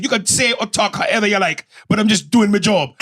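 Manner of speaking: high, defensive Jamaican retort; Jamaican woman. Retold or imitated. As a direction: stand your ground in a Jamaican accent. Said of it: "is a six-foot-two Jamaican woman".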